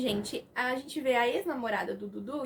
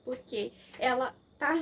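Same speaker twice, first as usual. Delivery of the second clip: ordinary talk, nothing marked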